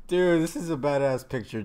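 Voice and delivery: usual speaking voice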